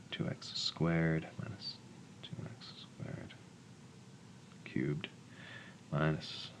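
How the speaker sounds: slow, soft working-out mumble; mumbling to oneself. Real, not acted. Tutor working through long division alone, mumbling to self. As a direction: mumble the sums under your breath. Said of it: "I'm just gonna mumble to myself".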